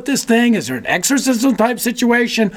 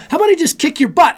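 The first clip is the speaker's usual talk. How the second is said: much higher, fast hammed-up challenge; mock threat; 10 semitones higher, faster than usual. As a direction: blurt it out like a cocky brawler. Retold charge at associate pastor.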